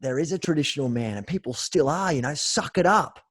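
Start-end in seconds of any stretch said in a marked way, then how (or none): 2.3-3.2 louder, higher barked "man up"; mock tough guy. Host mimics the traditional man telling others to toughen up, mock bark. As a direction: parody a blokey order to toughen up.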